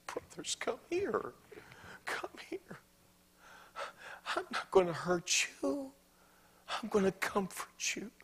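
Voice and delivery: soft, high, gentle call to his brothers; tender Joseph. Acted. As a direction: coax them close, barely above a whisper. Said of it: "Joseph wept".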